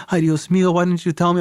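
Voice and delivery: a high, alarmed mother's voice; high-pitched mom cry, ay Dios mío; high worried mom. Retold or imitated. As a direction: voice mom in Spanish dismay.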